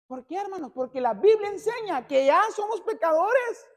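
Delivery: loud, high shouted sermon; preaching at full force. Acted. Pastor drives home sin doctrine to the church, shouting high. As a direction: thunder the doctrine at the pews.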